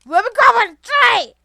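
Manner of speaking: high, loud yell at the game; frantic shout. Real, not acted. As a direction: shout in a scramble to grab on.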